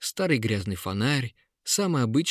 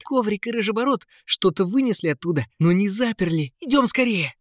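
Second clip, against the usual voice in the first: put-on excited boy; 9 semitones higher, 5 dB louder than usual. A narrator's reading. Boy urging friend to go.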